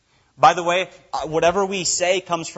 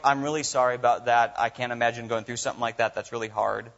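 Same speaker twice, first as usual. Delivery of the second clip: mildly comforting someone grieving; comforting a friend who lost someone, soft and low; gentle sympathy; 6 semitones lower, 3 dB quieter than usual. Retold or imitated. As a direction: lower and softer, quick consoling words. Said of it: "normally you say things like".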